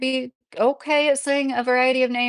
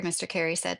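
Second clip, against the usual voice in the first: normal talking voice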